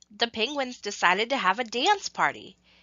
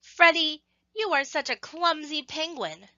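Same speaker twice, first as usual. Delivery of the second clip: teasing penguins; 6 semitones higher than usual. Acted. Penguins tease clumsy Freddy again.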